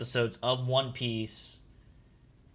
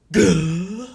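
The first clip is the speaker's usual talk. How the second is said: loud, higher flabbergasted cry; 5 semitones higher, 10 dB louder than usual. Acted. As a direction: blurt it out, totally stunned. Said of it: "He's, like, flabbergasted".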